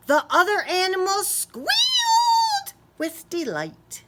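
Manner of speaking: high quick excited narration; bright squeal of glee; squealing delight. A narrator's reading. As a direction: let the squeal into the words.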